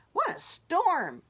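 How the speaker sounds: high chirpy bird voice; twittering wren. Acted. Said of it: "twitters Wren".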